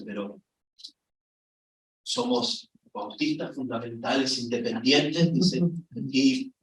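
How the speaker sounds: low, hushed confiding voice; imitating the pastor's hushed warning. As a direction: lean in and whisper it like a secret rule.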